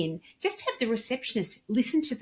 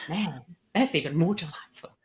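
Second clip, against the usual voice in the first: whispered aside; 2 semitones lower than usual. Real, not acted. Aside after phone demo.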